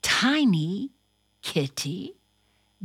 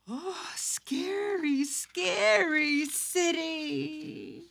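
slow, whispered mock fear; a little frightened breath; scared whisper; 7 semitones higher, 7 dB quieter, slower than usual. Puppet cat frightened by the dogs, whispering slowly.

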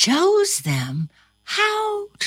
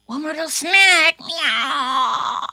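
cat voice; 7 semitones higher than usual. A narrator's reading. Cat character voice.

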